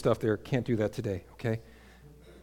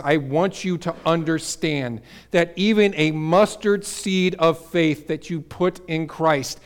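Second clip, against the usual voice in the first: usual speaking voice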